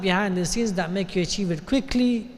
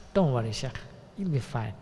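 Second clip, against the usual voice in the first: soft, lower, unhurried reassurance; calm and collected; 7 semitones lower, 9 dB quieter than usual. Retold or imitated. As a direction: settle a worried friend quietly. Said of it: "His reaction will be calm and collected".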